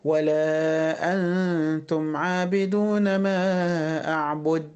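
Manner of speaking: slow recitation ending on an unreleased د in أعبد; missing qalqala. Shown as a demonstration. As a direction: stop the final د of أعبد dead, no bounce. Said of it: "ما ينفعش أقول إيه؟". (أعبد من غير قلقلة)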